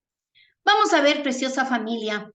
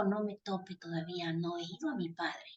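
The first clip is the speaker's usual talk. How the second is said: much softer, lower voice; hushed retold reply; 5 semitones lower, 13 dB quieter than usual. Preacher voicing Jesus answering Mary, hushed and low. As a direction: say it low and softly, as a gentle restraint.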